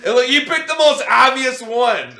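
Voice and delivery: loud laugh through the words; cracking up while teasing. Real, not acted. Bursts out laughing at pick.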